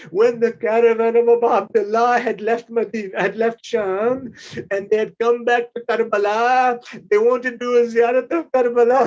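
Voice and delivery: loud, tearful mourning recitation; impassioned lament. Real, not acted. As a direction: cry out the tragedy in grief. Mourning narration, on verge of weeping.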